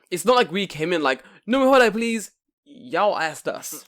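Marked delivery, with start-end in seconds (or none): 1.5-2.3 high, loud mock request; exaggerated eager ordering voice; mock pleading. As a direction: chirp it like an over-eager customer. Mocks themselves asking for the deal.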